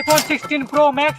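drawn-out announcer. Acted. Repeated prize announcement.

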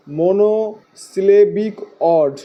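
"Monosyllabic অড" slowly, in separate chunks; teacher's slow repeat of "monosyllabic অড", part by part; slow, broken up. Shown as a demonstration. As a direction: break "monosyllabic অড" into mo-no, si-le-bik, od. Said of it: "কি বলা হয়?".

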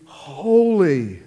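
holy called out loud and high; loud proclamation. Retold or imitated. Preacher voicing the angels, calling loud. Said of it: "and they go".

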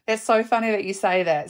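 ordinary talk

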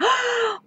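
high, sharp imitated gasp; mock gasp. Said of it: "She's like".

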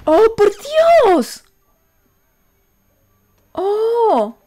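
high, loud, slow exclamation; shocked outcry. Real, not acted. Viewer shocked by a scene, high outcry. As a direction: cry out in real disbelief.

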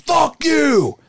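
high, loud mock yell; retold angry yell. Singer snaps back at the booing crowd, loud mock yell.

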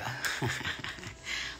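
low, quiet chuckle. Real, not acted. Amused by her Cougar Bill nickname, low chuckle. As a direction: chuckle softly to yourself.

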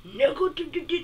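high-pitched mock confrontation; retold heated outburst. Friend getting in his face, mock heated. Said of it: "my friend gets heated".

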